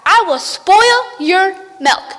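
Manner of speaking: loud, high, slow witch yell; yelled threat. Acted. Witch yelling back at the cows, loud and high. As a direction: hurl the threat back over the shoulder. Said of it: "yelled back".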